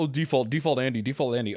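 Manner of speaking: sneering mock quote of chat, a bit fast. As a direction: mimic the viewers teasing, quick and dismissive. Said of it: "You're all".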